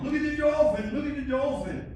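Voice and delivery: high, loud crowd cry; excited yelling. Retold or imitated. Passengers spotting dolphins around the ship, yelling. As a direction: yell it out like a whole deck of tourists. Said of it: "Everyone starts yelling".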